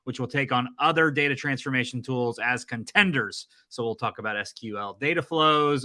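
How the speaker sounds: normal talking voice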